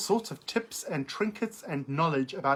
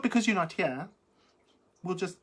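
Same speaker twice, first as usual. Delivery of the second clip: usual speaking voice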